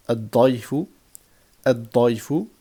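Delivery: loud "ad-dayfu", held emphatic d, no L; doubled first letter. Shown as a demonstration. Teacher drilling sun-letter words, doubled emphatic d. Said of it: "on va doubler".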